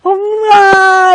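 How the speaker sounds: high, loud wailing cries; retold sobbing outcry; imitated crying wails. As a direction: let out sobbing wails like the real breakdown. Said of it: "crying and making movements like".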